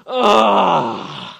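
loud grudging groan; reluctant groan. Retold or imitated. Pastor mimicking a reluctant believer with a loud groan. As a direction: groan like a chore you resent. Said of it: "it's not this reluctant".

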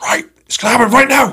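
shouts it high and loud, all at once; a burst of resolve, voice jumping way up; sudden high shout. Retold or imitated. Man on the platform psyching himself up, sudden high loud cry.

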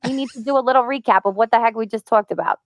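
lightly put-on New York accent; breezy New York accent, lightly done; Marisa Tomei impression. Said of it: "when you do that impression".

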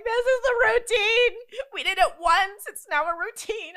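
put-on high, loud whiny toddler insisting; mock toddler meltdown. Parent imitating her toddler's routine tantrum. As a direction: play the upset two-year-old at full pitch.

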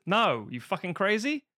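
incredulous refusal. Retold or imitated. Studio turning down Arnie's dubbing, incredulous. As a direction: stretch the "no" in imitated disbelief. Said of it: "and they said".

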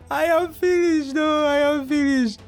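high laughing voice. Real, not acted. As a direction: laugh through the words. Laughing defeat.

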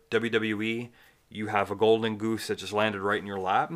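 ordinary delivery

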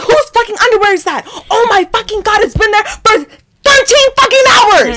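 high-pitched, ranting outrage; loud, worked-up retold tirade; furious outburst. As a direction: rant at the housemates in a shrieking fury. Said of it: "I get so angry".

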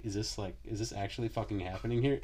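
softly voiced retold disbelief; hushed disbelief. Boyfriend to girlfriend as the whole room falls silent, hushed disbelief. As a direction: drop the volume, as if asking privately.

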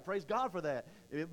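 normal talking voice

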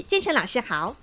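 ordinary talk, nothing marked

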